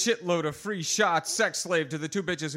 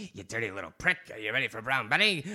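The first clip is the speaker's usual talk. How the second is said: lower, menacing taunt; low taunting woman; 8 semitones lower, faster than usual. Acted. Bar woman taunting the narrator, low and menacing. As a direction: sneer it down at him. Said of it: "one of the three cackled".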